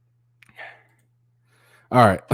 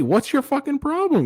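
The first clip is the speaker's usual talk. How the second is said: high, indignant mock yell; shrill mock shout of what's your problem. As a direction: squeal it in outrage.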